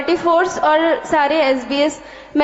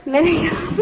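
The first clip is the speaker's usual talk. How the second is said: usual speaking voice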